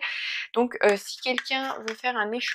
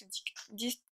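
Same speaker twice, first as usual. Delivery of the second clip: soft, stumbling false start; tongue-tied stumble; 11 dB quieter than usual. Real, not acted. Vlogger fumbles her question to viewers, tongue-tied. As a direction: trip softly over the first word. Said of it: "j'arrive pas à parler".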